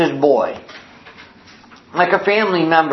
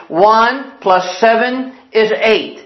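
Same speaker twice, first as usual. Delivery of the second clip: slow sing-along classroom drill; teacher drilling; slower than usual. Acted. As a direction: count it out slowly for the pupil. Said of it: "say it with me".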